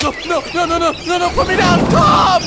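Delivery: high screaming pleas; terrified horror victim. Acted. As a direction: scream to be put down. Carried by pigeons.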